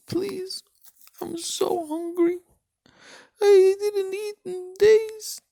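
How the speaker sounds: high pleading beggar voice; begging. Acted. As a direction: plead in a high, slow, broken whine. Poor man begging rich man.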